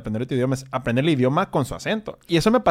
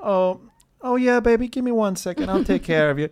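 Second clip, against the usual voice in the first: higher, sweet-talking Southern clerk; imitating a Houston saleswoman calling you sugar; Texan shop lady; 7 semitones higher than usual. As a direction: coo at the customer like a mother. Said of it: "como si fuera su hijo".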